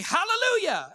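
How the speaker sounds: high, acted praise shout; called-out command. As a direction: call it out to halt a horse. Acted horse command in a joke.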